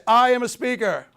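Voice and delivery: loud, proud and high; strong declaration. Shown as a demonstration. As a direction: declare it to the room with power. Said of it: "Everybody say".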